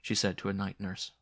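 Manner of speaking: ordinary delivery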